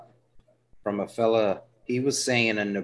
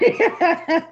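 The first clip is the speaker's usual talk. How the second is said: sudden high-pitched laugh; burst of high laughter; 7 dB louder than usual. Real, not acted. Host bursting into laughter at his friend's teasing. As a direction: crack up at the joke. Kids can't understand joke.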